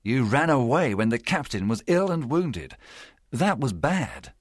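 understated, sorrowful scolding; sad reproach. A narrator's reading. Doctor faces Jim among the pirates, mildly sad. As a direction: reproach the boy gently, with a touch of sadness. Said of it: "The doctor began sadly".

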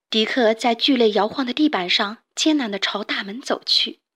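usual speaking voice

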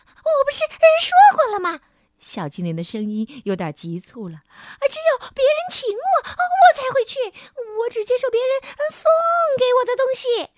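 fast high impatient sprite; hurried sprite voice. A narrator's reading. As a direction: rush it, getting impatient. (急促的小精灵声音)

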